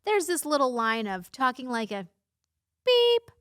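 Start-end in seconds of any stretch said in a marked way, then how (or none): 2.9-3.3 a sharp high beep said in place of a swear word; censor beep. Shown as a demonstration. Censoring a swear word for her viewers, high mouth beep. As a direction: bleep yourself out with a quick high-pitched beep. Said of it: "I'm gonna continue to censor myself".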